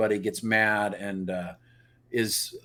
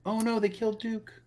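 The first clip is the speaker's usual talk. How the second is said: high, softer fake lament; mock dismay; 10 semitones higher, 7 dB quieter than usual. Joking about Duke being killed, soft mock dismay. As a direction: pitch it up in small, pretend alarm. Parodying grief over Duke's death.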